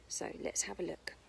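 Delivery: soft unvoiced whisper. Real, not acted. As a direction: hush it, let's peek together. Hushed to keep listening.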